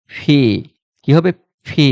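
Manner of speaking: "ফি" with air bursting from the lips; aspirated P. Shown as a demonstration. Teacher repeating the letter P, "ফি" with a puff of air. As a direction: puff the first sound of "ফি". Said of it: "একটা বাতাস বের হবে".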